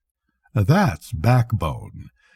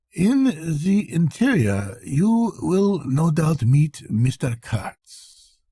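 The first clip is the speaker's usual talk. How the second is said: higher, slow clerk's voice, eyes on his work; remarking without looking up; offhand remark; 6 semitones higher, slower than usual. Acted. Accountant to narrator while writing, slow offhand clerk. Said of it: "One day he remarked".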